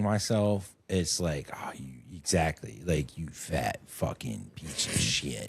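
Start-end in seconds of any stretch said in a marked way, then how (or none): 1.5-5.5 low, slow disgusted mutter; lower, slower muttered self-loathing; disgusted self-talk. Retold or imitated. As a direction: growl at yourself with contempt. Watching his own tapes.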